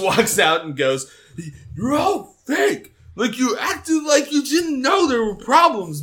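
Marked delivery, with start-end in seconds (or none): 1.8-6.0 high, loud mock yell, stretched out; Bobby yelling. Podcaster acts out Bobby's funeral outburst, yelling. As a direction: holler it like an over-the-top teen outburst. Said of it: "yelling under that tree".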